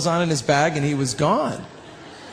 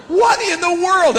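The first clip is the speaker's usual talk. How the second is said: slow, much higher astonished question; amazed; 12 semitones higher, 4 dB louder than usual. Retold or imitated. Hearing extra harmonies in the room, high and slow astonishment. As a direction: stretch it out in disbelief. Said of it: "And I'm going".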